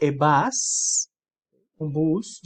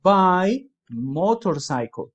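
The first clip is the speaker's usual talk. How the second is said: very slow, louder example phrase; slow louder model; 2 semitones higher, 5 dB louder, much slower than usual. Shown as a demonstration. Teacher to students, modelling the phrase slowly and louder. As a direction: say it slowly for the class. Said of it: "¿sí?".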